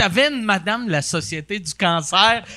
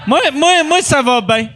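higher, loud bragging; mock boastful voice; 5 semitones higher, 3 dB louder than usual. Friend mimics someone boasting about their big thumbs. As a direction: brag about it loudly and proudly. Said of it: "Là, tu fais".

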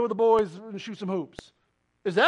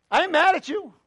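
sounding mad; 7 semitones higher, 5 dB louder than usual. Real, not acted. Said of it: "I may sound it".

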